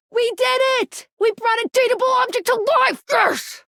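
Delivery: high, elated celebration; jubilant cheer. Acted. Celebrating with the player who brought an object to life, jubilant. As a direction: cheer the shared win in a high voice.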